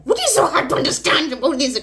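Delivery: high, loud exasperated outburst. Real, not acted. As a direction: burst out in disbelief. Exasperated outburst about nationality.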